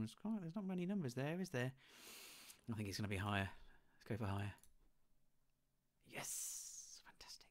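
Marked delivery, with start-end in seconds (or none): 6.1-7.5 whispered cheer. Real, not acted. Quiet cheer after guessing right.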